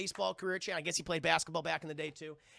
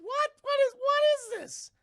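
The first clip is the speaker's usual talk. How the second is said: much higher, louder baffled cry; disbelief; 8 dB louder than usual. Retold or imitated. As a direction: squeal in confusion. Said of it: "I was like".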